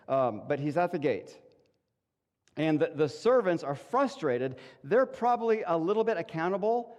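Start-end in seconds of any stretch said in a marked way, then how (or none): none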